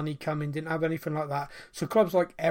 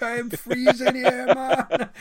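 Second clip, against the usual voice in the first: put-on high, loud West Indian accent; hammed-up Cool Runnings Jamaican accent, breaking into a laugh; Darren Sammy impression, Jamaican-style; 8 semitones higher, 8 dB louder, slower than usual. Freezing cricket commentator on air, imitated West Indian accent. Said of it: "sounds like one of the characters from Cool Runnings".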